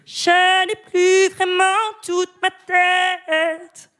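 high, slow, dragged-out singer's voice. Pretend. Chronicler imitates the singer for the radio audience, high and very slow. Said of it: "j'ai appris à imiter [name]".

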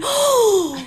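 high drawn-out mock gasp of pity; a put-on appalled "oh"; mock shock. Comedian imitates a man in the audience, mock shocked. Imagines a man's appalled reaction.

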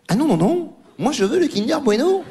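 high, hammed-up whiny insistence; mock insistent neighbour. Comic imitating the neighbour demanding the Kinder Bueno, high. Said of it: "elle fait".

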